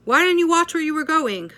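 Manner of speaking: put-on shouted high and loud at a hurt kid; angry yell at child. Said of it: "they start yelling at the kid".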